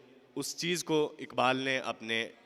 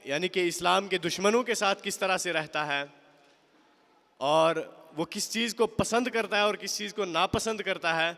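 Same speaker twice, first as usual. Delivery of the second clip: usual speaking voice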